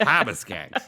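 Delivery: Harbor Skanks with a dropped r, hahbuh; Boston accent. Shown as a demonstration. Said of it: "I meant to do a Boston accent".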